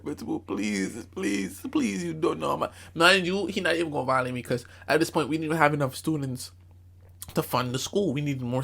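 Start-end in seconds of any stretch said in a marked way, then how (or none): 0.0-2.7 drawn-out mock pleading; begging. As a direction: plead with the principal, stretching each please. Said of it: "I'm on my knees in the office begging".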